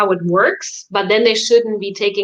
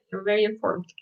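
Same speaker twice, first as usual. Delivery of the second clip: much softer, dropped-down repeat; 8 dB quieter, faster than usual. Acted. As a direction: say it softly, half to yourself. Teasing friend, softer repeat.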